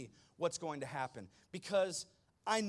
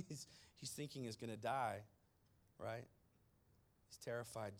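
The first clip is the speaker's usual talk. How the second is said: usual speaking voice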